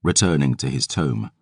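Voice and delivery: ordinary delivery, nothing marked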